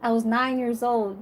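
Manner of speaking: ordinary talk, nothing marked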